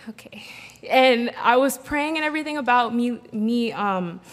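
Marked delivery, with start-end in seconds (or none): 0.1-0.4 sigh, then a very soft okay; barely voiced, resigned agreement; reluctant okay. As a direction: give in quietly with a sigh. Reluctantly giving in after being pressed.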